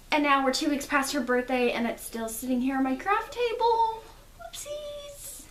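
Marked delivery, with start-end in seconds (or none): 4.4-5.4 high, tiny, cutesy voice; cutesy high-pitched oops; playful whoopsies. Real, not acted. Admits late birthday present.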